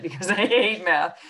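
quick, amused aside with a laugh; giggling admission she hates math; laughing through it. Real, not acted. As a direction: laugh off the confession.

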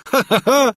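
high scornful ha-ha-ha; a hammed-up contemptuous cackle; mocking laugh. A narrator's reading. Prince mocking the rival, laughing.

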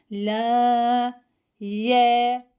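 "La" held for one elif length; lengthened recitation. Shown as a demonstration. Teacher showing the lam elif lengthening. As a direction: stretch "La" evenly. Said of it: "bir elif miktarı uzatılarak okunur".

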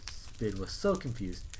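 ordinary delivery

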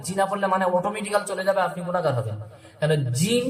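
ordinary talk, nothing marked

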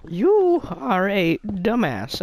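very high, loud, slow parrot screech; screeching parrot insult. Retold or imitated. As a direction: drag each word out like an angry parrot. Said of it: "And he's like".

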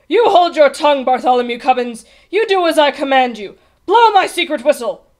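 loud, high-pitched royal order; angry command. A narrator's reading. King ordering page boy to obey, shouting. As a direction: shout him down imperiously.

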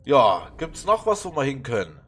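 usual speaking voice

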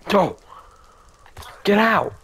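high, loud bark to get out; sharp shout of get out; shouted order. Real, not acted. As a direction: yell them off. Chasing mob away.

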